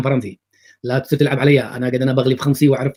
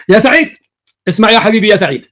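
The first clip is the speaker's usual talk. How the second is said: loud, high call for attention; high-pitched, forceful mock summons; 5 semitones higher, 6 dB louder than usual. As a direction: raise the voice as if hailing someone in mockery. Mock calling out to Saeed.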